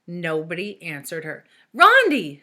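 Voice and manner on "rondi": high, loud desperate shout. A narrator's reading. Allison calling a classmate who ignores her, loud shout. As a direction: yell the name across the room. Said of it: "shouted Allison".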